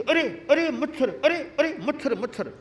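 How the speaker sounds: high, sudden cries at a mosquito bite; startled yelp. Retold or imitated. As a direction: jump at the sting.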